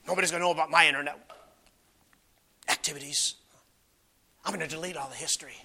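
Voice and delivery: quiet, fast, conspiratorial; sneaky hushed sinner. Retold or imitated. Preacher voicing a sinner hiding his internet history, hushed and fast. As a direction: mutter it like hiding your tracks. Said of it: "It's like".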